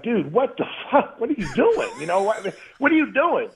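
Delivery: high-pitched, disbelieving protest; indignant protest. Retold or imitated. Knocked-down player confronting the man who hit him, indignant. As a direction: retell a stunned, indignant challenge.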